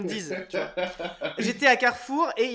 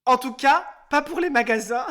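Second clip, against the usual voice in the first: playful retort, higher and louder; retold cheeky comeback; 4 semitones higher, 7 dB louder than usual. Host retelling his answer to the cashier, cheeky comeback. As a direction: toss back a teasing re-enacted reply.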